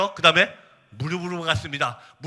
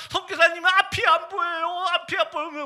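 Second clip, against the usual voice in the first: 울부짖는 흉내; 12 semitones higher than usual. Retold or imitated. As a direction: imitate a tearful youth crying out in distress. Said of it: "울면서". (울면서 길게 호소하는 청년 흉내)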